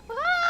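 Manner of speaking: a shrill cry of dismay; high wail. Real, not acted. Missing the rainbow subscriber again.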